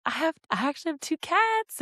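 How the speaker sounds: put-on high, kid-friendly show-and-tell voice; sweetly talking down to children. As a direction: pitch it up for little listeners. Retelling herself chatting to kids.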